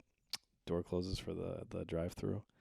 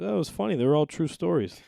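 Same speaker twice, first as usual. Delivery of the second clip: imitating dad; 10 semitones higher, 10 dB louder, faster than usual. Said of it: "He goes".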